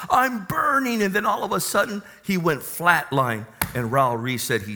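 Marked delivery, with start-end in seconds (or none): 0.1-1.0 higher, retold cry of terror without real volume; half-hearted retold scream of terror; mild mock scream. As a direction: echo a dying man's scream, understated. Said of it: "started screaming his lungs".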